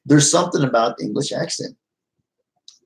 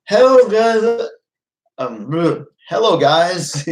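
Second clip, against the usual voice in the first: slow, high, loud just-woken greeting; groggy morning hello; 9 semitones higher, 4 dB louder, much slower than usual. Retold or imitated. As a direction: mimic yourself before coffee, higher and louder. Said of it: "and you're like".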